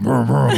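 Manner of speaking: playful high vroom noises; imitated engine revving. Biker joking about his moped, imitated engine revving. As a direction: rev it like a toy motorbike.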